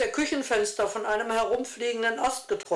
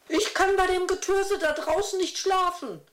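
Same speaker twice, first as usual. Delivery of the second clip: high, put-on voice of a protesting old lady; a sulky complaint in a high character voice; 10 semitones higher than usual. A narrator's reading. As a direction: grumble back defiantly, pitched high. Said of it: "muckte eine der Frauen auf".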